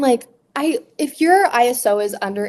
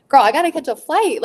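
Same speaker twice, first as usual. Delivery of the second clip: impatient sass; 6 semitones higher, 4 dB louder than usual. Retold or imitated. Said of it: "and I'm like".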